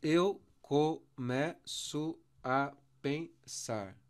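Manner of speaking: very slow, chopped into single syllables; measured syllable count, small gaps between; separated syllables. Shown as a demonstration. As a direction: break the line up beat by beat. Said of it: "Deixa eu separar aqui".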